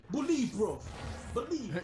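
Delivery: put-on high-pitched British street accent; John Boyega. Recommending a film to a friend, mock British accent. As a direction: put on a punchy London film-gangster accent. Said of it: "John Boyega and he's like".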